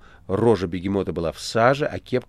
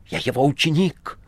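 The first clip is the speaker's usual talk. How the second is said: angry; 7 semitones higher than usual. A narrator's reading. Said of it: "всё более озлобляясь".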